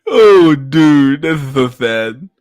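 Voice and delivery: loud laugh-cry over a dark meme; fake-sad while cracking up; laughing mock sadness. Real, not acted.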